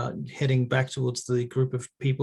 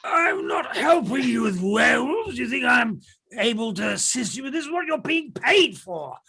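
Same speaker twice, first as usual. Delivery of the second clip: put-on high shouting old man; angry old man; 9 semitones higher than usual. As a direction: yell like a furious old grouch. Old man refuses angrily.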